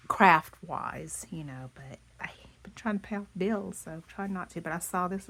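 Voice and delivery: ordinary talk, nothing marked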